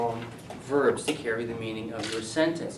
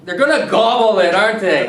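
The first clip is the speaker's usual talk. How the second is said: loud, higher "They're gonna gobble it" in delight; excited; 7 semitones higher, 12 dB louder than usual. Real, not acted. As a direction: cheer the student's word, loud. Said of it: "That's a great word".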